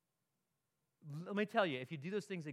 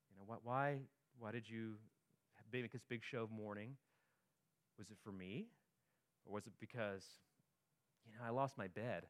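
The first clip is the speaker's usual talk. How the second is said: hushed questioning; 7 semitones lower, 9 dB quieter than usual. Acted. God questioning the people's fasting.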